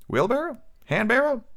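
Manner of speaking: high, puzzled double-checking of the word; puzzled question. Real, not acted. Reader puzzling over an odd word, high and baffled. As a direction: ask it as if the word makes no sense. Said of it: "puzzled at the word".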